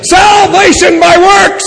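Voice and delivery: high, drawn-out mock accusation; mock outcry. Preacher mimicking an accusation of false doctrine, mock outcry. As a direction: stretch it out like an outraged accuser.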